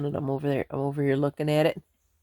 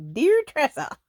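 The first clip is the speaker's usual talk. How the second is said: squeaky joke voice; 7 dB louder, slower than usual. Pretend. Joking pun on deer.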